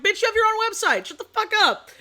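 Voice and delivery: high, loud shut-up retort; exasperated outburst. Retold or imitated. Mocking a critic who has her own website, loud retort.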